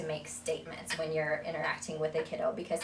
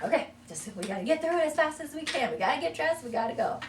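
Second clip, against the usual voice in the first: higher, louder hurried "we gotta go"; rushed parent; 9 semitones higher, 5 dB louder than usual. Retold or imitated. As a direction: rush through the routine. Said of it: "we're like".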